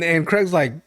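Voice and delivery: ordinary delivery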